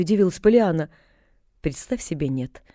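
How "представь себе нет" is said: dry, clipped and softer; dry reply. A narrator's reading. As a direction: answer curtly, voice sinking. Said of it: "сухо отозвалась миссис Сноу".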